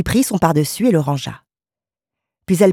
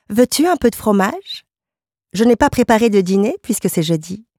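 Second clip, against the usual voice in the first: higher, lilting wifely voice; devoted wife, solicitous; 5 semitones higher than usual. Acted. Wife offering her tired husband some food, solicitous. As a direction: offer it helpfully, pitched up.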